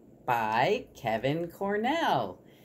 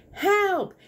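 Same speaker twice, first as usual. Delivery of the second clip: high, loud cry for help; panicked squirrel; 6 dB louder than usual. Acted. Squirrel barreling into the chicken coop, panicked. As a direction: yelp it high like a frightened squirrel. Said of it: "He was out of breath and in a panic".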